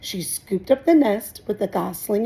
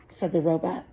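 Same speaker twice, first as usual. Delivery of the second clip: ordinary delivery, nothing marked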